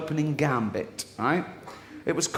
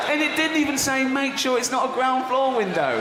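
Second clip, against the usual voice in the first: incredulous yell; 10 semitones higher, 3 dB louder than usual. Real, not acted. Continuing outraged.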